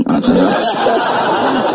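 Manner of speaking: tawa pendek bernada tinggi. Real, not acted. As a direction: tertawa geli. Speaker laughs. (tawa geli yang pendek)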